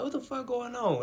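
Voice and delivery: high, incredulous squeal of retold shock; high-pitched bewildered outcry; startled disbelief. Retells startled reaction to voice.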